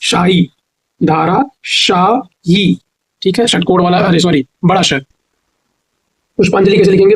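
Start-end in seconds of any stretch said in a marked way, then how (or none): 0.0-2.8 very slow, धाराशाही drawn out syllable by syllable; slow dictation. Shown as a demonstration. Teacher dictating धाराशाही slowly. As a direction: stretch धाराशाही as dhaa-raa-shaa-hee. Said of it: "धाराशाही में धाराशाही".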